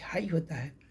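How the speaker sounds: ordinary talk, nothing marked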